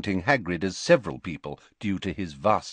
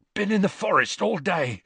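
panted; 10 semitones higher than usual. A narrator's reading. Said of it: "he panted".

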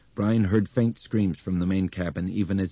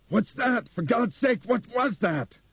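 much higher, frightened voice; a scared passenger panicking at the noise; panicked cry; slower than usual. A narrator's reading. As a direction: blurt it out in terror. Passenger panics after thud.

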